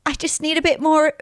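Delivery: high, pleading mimicry; contestant impression. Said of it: "I wish I could do her accent".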